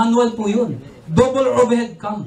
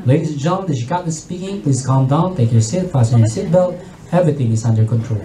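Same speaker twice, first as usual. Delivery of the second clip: low, calm pilot announcement; calm captain; 9 semitones lower than usual. Acted. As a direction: speak low and composed like a captain. Captain voice again.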